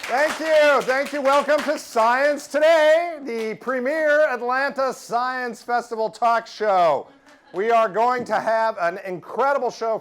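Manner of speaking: big, loud, high welcome over applause; show host opening. Real, not acted. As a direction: stretch it out like a talk-show announcer.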